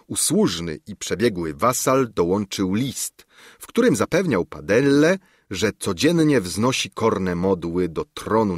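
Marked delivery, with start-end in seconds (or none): none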